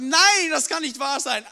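loud disbelieving cry; shocked outcry. Retold or imitated. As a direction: blurt it out in shock. Reading about shark biting probe.

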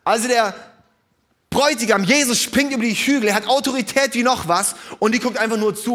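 loud, high fervent preaching; full-force sermon voice. Acted. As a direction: drive it home to the congregation.

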